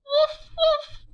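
put-on bouncy high puppy barks for kids. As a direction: bark like a little puppy. Dog barks in picture book.

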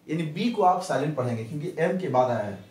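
usual speaking voice

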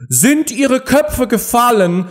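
high, drawn-out cry; shouting queen. A narrator's reading. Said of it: "schrie die Königin sie an". (schreiende Königin)

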